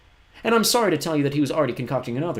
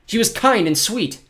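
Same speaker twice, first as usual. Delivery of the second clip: put-on much higher, indignant boy's cry; cried out; 11 semitones higher, 8 dB louder, slower than usual. A narrator's reading. Klaus defends Josephine against Olaf, crying out. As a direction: Klaus protesting, voice pitched high. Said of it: "Klaus cried".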